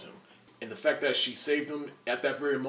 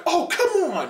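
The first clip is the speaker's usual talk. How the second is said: high, loud fed-up shout; mock exasperated yelp; exasperated cry; 9 dB louder, slower than usual. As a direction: yell in frustration. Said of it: "It's like".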